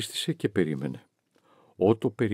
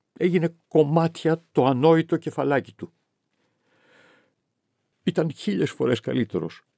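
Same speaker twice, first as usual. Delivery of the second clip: bitter outburst; 7 semitones higher than usual. A narrator's reading. Victoria berates Johannes over Otto's death, bitter outburst. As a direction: throw the words at him in grief and rage.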